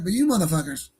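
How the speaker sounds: usual speaking voice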